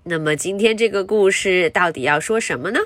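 ordinary delivery

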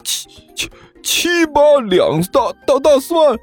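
high, stammering shock; stunned disbelief. Acted. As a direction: choke on the words, then squawk them. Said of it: "整个人都斯巴达了". (震惊得结结巴巴)

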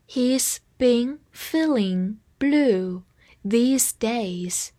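very slow, each word drawn out and separate; slow read-along. Shown as a demonstration. Teacher asks learners to read along, slow reading. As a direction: lead learners through it word by word. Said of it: "跟我慢读一遍".

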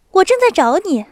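高声急切的少女; 兴冲冲地喊哥哥; 激动的少女. A narrator's reading. 娜达莎急着找哥哥. (兴奋的年轻姑娘)

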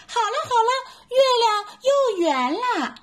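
high, delighted little monkey cry; happy shout. Acted. Little monkey sees the moon whole again, high happy cry. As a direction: cheer out in relief. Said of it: "赶紧高兴地喊".

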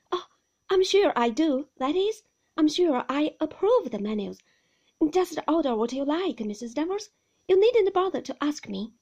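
eager to please, flustered. A narrator's reading. Flustered young wife on the phone.

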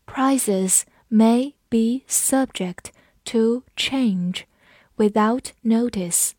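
slow, words spaced apart; slow model sentence; slow read. Shown as a demonstration. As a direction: read it out slowly for learners. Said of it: "我们慢读一遍".

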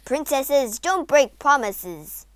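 loud, slow, stern rule; stern king. A narrator's reading. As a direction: lay down the law deliberately. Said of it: "interrupted the King sternly".

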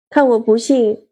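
plain reading voice

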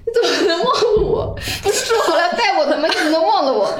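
high, playful whiny coaxing; cutesy whiny pleading. Retold or imitated. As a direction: act spoiled and pouty, begging. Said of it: "所以我是一种撒娇的状态".

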